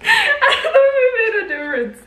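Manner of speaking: laughing. Real, not acted. Laughing at her own question.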